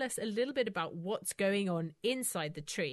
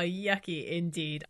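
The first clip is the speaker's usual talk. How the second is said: usual speaking voice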